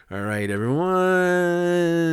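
high, loud, stretched-out "everyone"; playful drawn-out ready-set cue; drawn-out send-off. Pretend. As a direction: hold the last word long, high and loud. Countdown-style cue to pause and watch.

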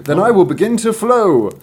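high, loud climactic declaration; declaiming little spring. Acted. Spring resolves to flow at the climax, loud declaration.

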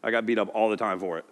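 sheepish Peter. Retold or imitated. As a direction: mumble it like the one always scolded.

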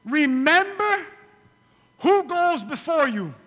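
slow, loud, high emphasis; emphatic. Acted. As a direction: drive the point home. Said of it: "Write this down".

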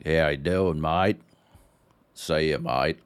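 a hint of cheesy Australian game voice acting. Mocking the Australian game's voice acting, light Australian accent. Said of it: "just the most stereotypical Aussie bargain".

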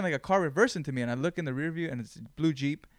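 ordinary talk, nothing marked

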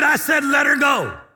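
high, loud, no-nonsense command; insistent shout. Retold or imitated. Wigglesworth insisting to the ladies, an insistent shout. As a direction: brook no argument.

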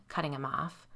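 ordinary delivery

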